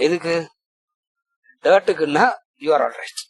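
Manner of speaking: ordinary talk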